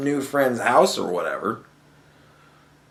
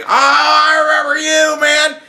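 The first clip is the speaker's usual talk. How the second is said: high, loud happy yelp of recognition; a dog overjoyed to see you again; excited dog shout; 11 semitones higher, 4 dB louder than usual. Retold or imitated. Dog greeting an old friend after a year, high excited shout.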